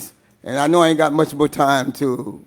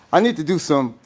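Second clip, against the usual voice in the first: ordinary talk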